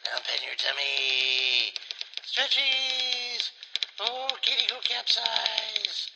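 high, slow cooing to a cat; sing-song for a kitten. Real, not acted.

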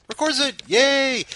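high, loud playful yay; a hammed-up little celebration; mock cheer. Real, not acted. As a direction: cheer her on with teasing delight. Host mock-celebrating Chelsea recording.

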